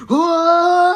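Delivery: high, loud "whoa" scream; weird mock scream. Shown as a demonstration. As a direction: belt out a long, high cartoon scream. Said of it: "sound weird when they scream".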